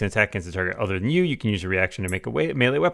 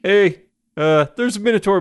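lightly Canadian, a bit louder and higher; a hint of Bob and Doug McKenzie Canadian; unplanned Canadian accent slipping into the line; sudden Canadian voice; 7 semitones higher, 4 dB louder than usual. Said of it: "Canadian all of a sudden".

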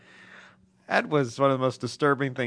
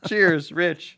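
ordinary delivery

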